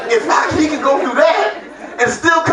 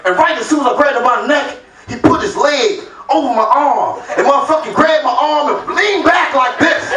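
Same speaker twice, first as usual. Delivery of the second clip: ordinary talk, nothing marked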